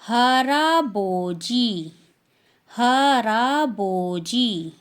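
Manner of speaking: "हराबोजी" slow and drawn out; teacher modelling Korean "हराबोजी"; Korean word. Shown as a demonstration. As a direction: model "हराबोजी" slowly twice. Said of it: "grandfather को".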